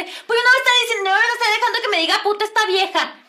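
fast, high, loud retort; outraged protest. Retold or imitated. Girl refusing to be insulted, loud outrage. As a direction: fire back indignantly in a re-enacted row. Acted furious reply to the scolding.

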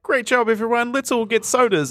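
high, cheery mock coach; upbeat coach. Little League coach rewarding his winning team, high and cheery. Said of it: "the coach is like".

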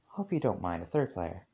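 put-on soft, flat-toned girl; blank and soft. A narrator's reading. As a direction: say it softly with no emotion. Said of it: "Her voice was blank, yet soft".

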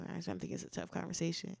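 normal talking voice

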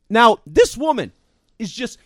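normal talking voice

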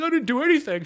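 high-pitched, loud mock protest; retold defensive squeal, voice jumping way up; protesting denial. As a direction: plead innocence in a high voice. Denying the teacher's accusation.

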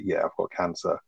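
deadpan delivery. Retold or imitated. Host imitating Walt telling family he has cancer, deadpan. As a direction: drop the news plainly with no drama. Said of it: "it's delivered so simply".

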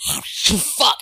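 cursing while cracking up; laughing curse. Real, not acted. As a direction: swear through a laugh. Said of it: "nope, I cannot".

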